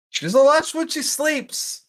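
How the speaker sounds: amused squeal. Acted. Amused comment, much higher.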